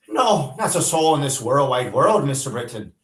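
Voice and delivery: high, loud pleading wail; wailing maid. A narrator's reading. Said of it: "Peggy wailed".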